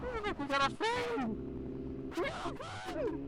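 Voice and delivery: very high, delighted squeal; excited squeal. Real, not acted. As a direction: squeal with delight at a new friend. Spots another rider, squeals.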